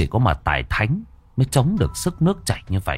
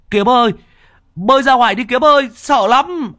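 high, loud panicked call to a friend; frightened calling; 6 dB louder than usual. Acted. Friend on the bank calls Kiếm, high panicked yell. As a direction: yell it out, scared for him.

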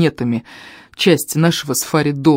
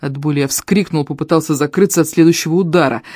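plain reading voice

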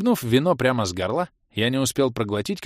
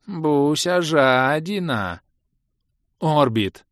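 drawled; 6 semitones higher, 4 dB louder, much slower than usual. A narrator's reading. Orbit's drawl, drawn out.

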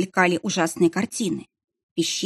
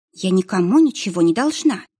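plain reading voice

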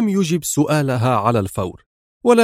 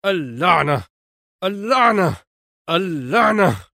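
higher-pitched cursing, a little restrained; mild angry yell; angry cry; 5 semitones higher than usual. A narrator's reading. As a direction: repeat the curse in mounting exasperation. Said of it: "ويصرخ".

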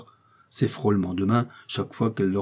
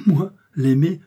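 high, louder indignant echo; indignant, pitched-up retort; scornful disbelief; 7 semitones higher, 6 dB louder, slower than usual. A narrator's reading. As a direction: throw the word back in disdain. Milady scorns idea of loving him.

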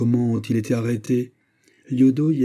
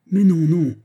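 higher, louder outcry of denial; exclaiming loudly; 8 semitones higher, 7 dB louder than usual. A narrator's reading. Fouquet to Gourville, a loud, high protesting cry. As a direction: cry out the no's. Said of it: "s'écria encore une fois Fouquet".

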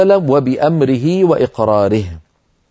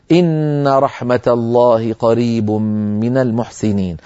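slow, melodic recitation; Quran recitation; 3 semitones lower, slower than usual. Acted. Teacher quotes the verse in recitation tone.

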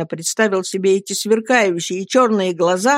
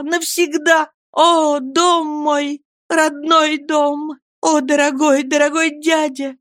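despairing confession; 6 semitones higher than usual. A narrator's reading. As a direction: mourn the home and uncle she lost. Emily's long despairing confession.

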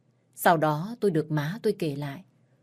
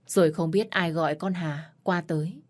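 usual speaking voice

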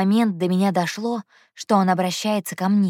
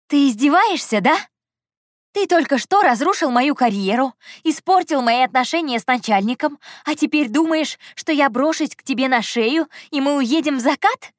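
high-pitched indignant sarcasm; venomous; 7 semitones higher, 4 dB louder than usual. A narrator's reading. Heroine refuses to leave with Andrey, venomous. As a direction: pour out scorn, pitch up throughout. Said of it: "В моём голосе был яд".

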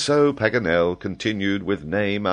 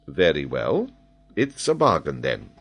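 composed, understated deal-making; calm Major. Acted. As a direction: accept the bet coolly. Major calmly accepts the wager.

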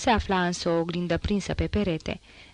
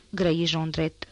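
ordinary delivery, nothing marked

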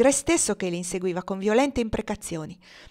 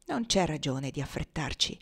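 lower, softer, unhurried reply; steady her with quiet calm; calm and tranquil; 4 semitones lower, 5 dB quieter than usual. Acted. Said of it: "disse tranquillamente Cassie". (tono tranquillo, più basso e sommesso)

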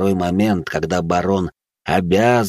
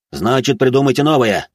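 angry command in a raised, higher voice; director's shouted order; 5 semitones higher, 3 dB louder than usual. Acted. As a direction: overrule the assistant heatedly.

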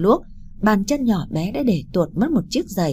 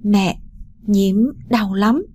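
put-on a hurt child's weak voice, slow; faint, slow child's whimper; weak little voice; 2 semitones higher, much slower than usual. A narrator's reading. Injured little girl telling her mother it hurts, weak and slow. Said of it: "giọng nói yếu ớt vang lên".

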